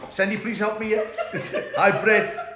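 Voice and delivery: giggling while pleading for help; laughing. Real, not acted. Overwhelmed by live chat greetings.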